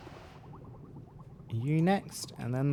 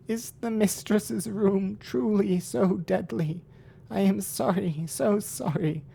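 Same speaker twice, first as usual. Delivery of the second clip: higher, begging goat voice; 8 semitones higher, 4 dB louder, slower than usual. Acted. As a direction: beg for forgiveness, higher. Goat servant begs, higher.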